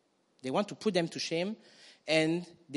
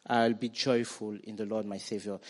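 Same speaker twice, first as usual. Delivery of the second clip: full-force preaching; 3 semitones lower, 7 dB louder than usual. Acted. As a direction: proclaim it to the congregation. Congregation declares the verse.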